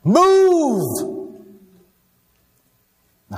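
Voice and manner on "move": high, very loud, stretched-out shout; one long, full-voiced yell; urgent shout. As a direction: bellow it as an urgent order from the water. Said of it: "long enough to shout".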